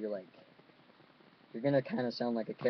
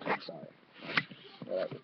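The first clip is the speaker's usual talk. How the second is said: ordinary talk, nothing marked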